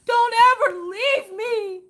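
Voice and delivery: very high, slow, loud fake wail; mock sobbing plea. Wife to returning husband, hammed-up sobbing plea. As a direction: wail it like a needy puppy. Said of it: "and I'm like".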